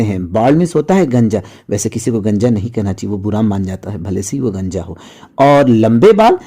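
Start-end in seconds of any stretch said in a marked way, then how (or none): 1.7-5.0 softer, dropping into a confiding aside; a hushed word of advice; quiet aside. Real, not acted. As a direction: say it softly, as a gentle tip to the class. Aside about not calling people bald.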